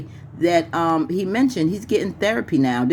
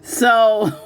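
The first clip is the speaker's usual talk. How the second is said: high laughing fit; burst of laughter; 4 dB louder than usual. Real, not acted. Host recalling the movie, bursting into high laughter. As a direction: crack up mid-sentence.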